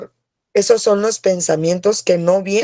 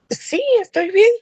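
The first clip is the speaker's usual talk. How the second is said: much higher-pitched quick-answer voice; retold reassurance; 13 semitones higher than usual. Passenger answering worried people after a bump, high-pitched retold reassurance. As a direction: imitate yourself answering in a high little voice.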